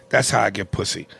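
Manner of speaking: normal talking voice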